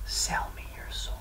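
unvoiced, secretive whisper. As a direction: lean in and whisper the offer. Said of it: "then whispered".

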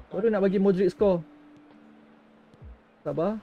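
ordinary delivery

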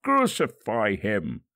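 the mob demanding crucifixion, loud and slow; slow crowd shout. A narrator's reading. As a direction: drag out the demand for death. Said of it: "they shouted".

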